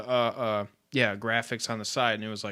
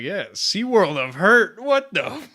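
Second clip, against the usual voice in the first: disbelieving; 8 semitones higher, 7 dB louder, slower than usual. Retold or imitated. Voices Ryan's disbelief.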